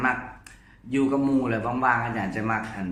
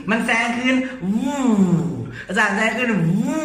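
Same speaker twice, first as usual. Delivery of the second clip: excited whoop; 8 semitones higher, 4 dB louder than usual. Retold or imitated. Imitating race cars with friends.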